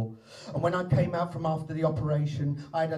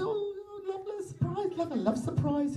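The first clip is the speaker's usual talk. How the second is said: mock gushing; 12 semitones higher, 5 dB quieter than usual. Wife gushing at surprise.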